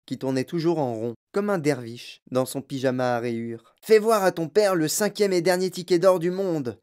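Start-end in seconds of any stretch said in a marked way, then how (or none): 3.8-6.8 higher, proudly proclaimed order; excited urging. A narrator's reading. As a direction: trumpet the great news to the room. Grandfather excitedly urges the boy.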